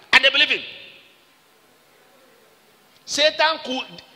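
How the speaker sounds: fiery pulpit cry; preaching shout. Acted. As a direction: lift it up to the congregation.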